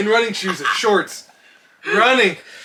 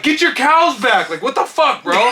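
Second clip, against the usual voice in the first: high, loud, drawn-out demand; retold yelling; 7 semitones higher, 5 dB louder than usual. Dog owner yelling at the farmer, loud and high. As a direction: holler an indignant complaint. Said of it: "I'm yelling at the farmer".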